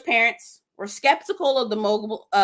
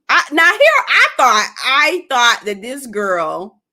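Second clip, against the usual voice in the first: loud, emphatic, dragging out the words; worked-up outburst; 2 semitones higher, 8 dB louder, slower than usual. Real, not acted. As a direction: blurt it out loudly, restarting in disbelief. Outburst at shocking parent revelation.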